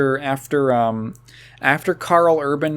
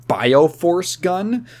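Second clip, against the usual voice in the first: usual speaking voice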